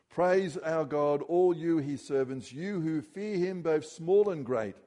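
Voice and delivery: slow, loud call to praise; proclaiming. Acted. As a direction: call the servants to praise, drawn out. Voice from throne quoted again.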